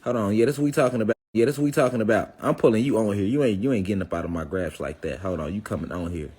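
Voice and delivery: ordinary talk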